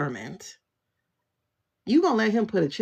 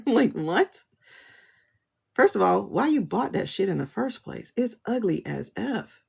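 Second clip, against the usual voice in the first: ordinary delivery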